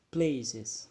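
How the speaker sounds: "Places" with the weight on "pla", "ces" light and falling; model word for repeating. Shown as a demonstration. Teacher models the word for the class to repeat, stress on PLA. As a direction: say "Places" clearly, PLA strong and ces weak. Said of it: "Let's pronounce together".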